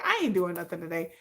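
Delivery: low soft eager calm; relaxed relish. Retold or imitated. Itching for a comment fight, low and soft. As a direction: savour the free time quietly. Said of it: "I was like".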